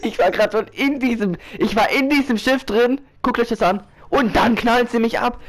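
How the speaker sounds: high-pitched, rapid disbelief at being shot; excited gamer outburst. Real, not acted. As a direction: gamer to viewers, just ambushed inside the ship, excited and high-pitched. Nearly shot in the game.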